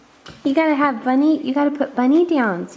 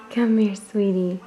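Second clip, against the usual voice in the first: ordinary delivery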